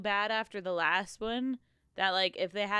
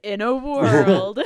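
movie trailer voice; 11 dB louder, slower than usual. Retold or imitated. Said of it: "trailer reading voice".

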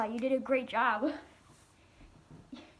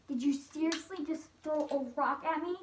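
usual speaking voice

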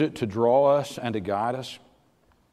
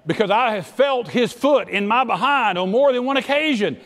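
loud fervent preacher shout; preaching at full force; 9 semitones higher, 4 dB louder than usual. Acted. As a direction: hammer each point from the pulpit.

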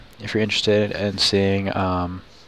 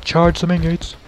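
high, playful character voice; rally the troops in a high mock voice; mock battle cry; 8 semitones higher than usual. Gamer voicing the angry-faced character, high mock battle cry. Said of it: "But he's like".

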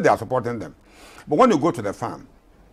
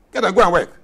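higher and louder, punching "go"; forceful shout; 7 semitones higher, 7 dB louder, faster than usual. Real, not acted.

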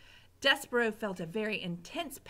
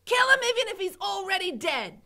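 higher, louder bossy yell; cook shouting; 10 semitones higher, 8 dB louder than usual. Acted. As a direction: bark the rule at Mig. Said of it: "shouted the cook".

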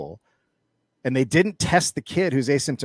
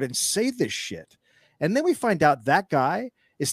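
genuinely appalled, voice raised; outraged rant; 6 semitones higher than usual. Real, not acted. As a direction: spit out the disgust at the comparison. Outraged at board member's comparison.

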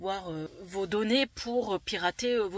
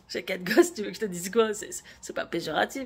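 indignant loud retort; 7 dB louder than usual. Real, not acted. Angry reply to critics on gosses.

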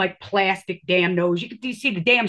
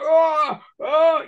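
put-on higher, louder creaky old man noises; mock old man groan; 6 semitones higher, 6 dB louder than usual. Hunched old man with cane.

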